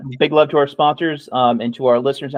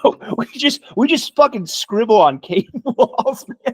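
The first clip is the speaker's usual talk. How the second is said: high, sarcastic outburst with a laugh; mock indignation; 9 semitones higher than usual. Irishman asked about universities, mock indignation. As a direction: play the offended caveman-student, pitched up. Sarcastic answer to ignorant question.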